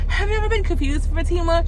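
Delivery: high, fast mock plea; a touch of teary exasperation; lightly voiced fed-up boyfriend; exasperated question. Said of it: "He's like".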